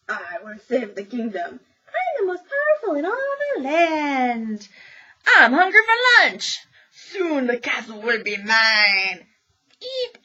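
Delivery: hammed-up villain, high and loud, slowing on the threat; character voices for story time. Shown as a demonstration. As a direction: ham it up like a storybook baddie grabbing the castle. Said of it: "say it in a voice to match who's talking".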